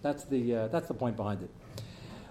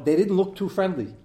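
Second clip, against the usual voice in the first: usual speaking voice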